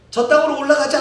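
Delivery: calling out loud and high; rallying call. Acted. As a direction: urge the crowd upward. Two sides argue, go up.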